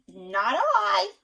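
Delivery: high, loud duck voice refusing. Acted. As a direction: quack out a flat no. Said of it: "The duck said".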